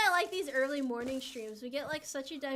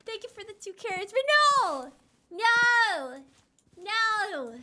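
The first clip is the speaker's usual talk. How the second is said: high-pitched, full-volume mock yelling; over-the-top shouted protest; mock screaming outburst; 10 semitones higher, 14 dB louder, slower than usual. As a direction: scream it high and loud, stretching each no.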